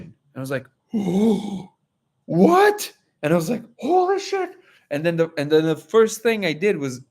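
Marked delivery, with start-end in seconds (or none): 0.9-1.6 gasp. Retold or imitated. Frog's real information shocks him. 2.3-2.9 very high, loud disbelieving "What?"; astonished cry, pitched far up; shocked what. Retold or imitated. Stunned by the frog's message. 3.8-4.5 very high, amazed "Holy shit"; stunned awe. Retold or imitated. Reacting to the revelation.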